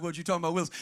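low, offhand catchphrase; catchphrase aside. Retold or imitated. Preacher drops in a sitcom line mid-story, low aside.

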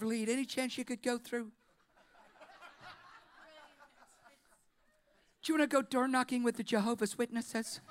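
light, chuckling sales pitch; mock church recruiter. Jesus imagined pitching classes to the thief, laughing. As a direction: breezily pitch church programs.

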